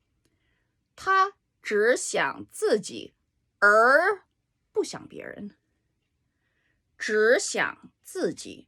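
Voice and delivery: "他只想自己" drawn out slowly as a model sentence; Chinese sentence. Shown as a demonstration. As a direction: read the example "他只想自己，而不想别人" slowly. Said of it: "It means he only thinks of himself".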